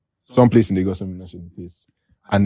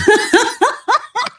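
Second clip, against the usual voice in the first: high burst of laughter. Real, not acted. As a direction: crack up. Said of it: "I'm sorry that I'm laughing".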